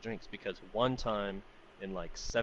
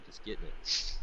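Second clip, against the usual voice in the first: usual speaking voice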